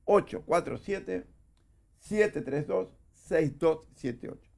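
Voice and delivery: each digit spaced out, slow and deliberate; slow careful dictation of digits; slowly. Shown as a demonstration. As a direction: read the number back slowly for dictation. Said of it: "Te lo repito despacito".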